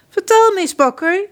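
high, loud royal demand; prince John. A narrator's reading. Prince John interrogating the baker, high and loud. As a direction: bark it at the baker like a spoiled prince.

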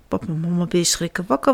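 usual speaking voice

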